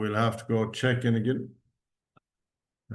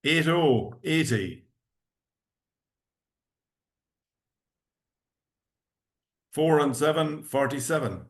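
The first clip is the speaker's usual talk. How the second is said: bingo caller. Acted. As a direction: call each number out clearly. Calling bingo numbers.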